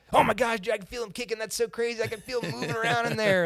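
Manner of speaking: high, thrilled gush of wonder; ecstatic. Retold or imitated. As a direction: sound amazed, like it's the best moment of your life. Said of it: "just the most ecstatic I've ever seen him".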